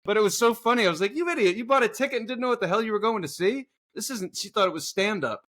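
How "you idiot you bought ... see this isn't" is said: mock scolding. Mock scolding absent woman.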